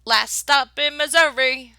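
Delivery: loud, high announcement; shouted stop call; driver shouting. Acted. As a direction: call it out to the whole coach. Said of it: "shouted the driver".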